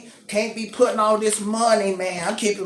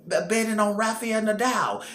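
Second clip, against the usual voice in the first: usual speaking voice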